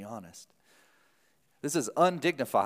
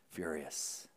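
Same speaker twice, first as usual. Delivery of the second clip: much softer, almost breathed; hushed echo; 8 semitones lower, 13 dB quieter than usual. Acted. Preacher repeating the older son's anger to the church, hushed. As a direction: drop the word to a hush. Echoes the word in a hush.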